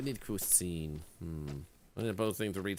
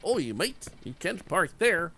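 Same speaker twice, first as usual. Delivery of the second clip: jokey put-on Canadian accent, slightly higher; mock Canadian accent; 6 semitones higher than usual. Telling a driver off in a mock Canadian accent. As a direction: play-act a Canadian telling someone off, lightly voiced. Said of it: "in a Canadian accent".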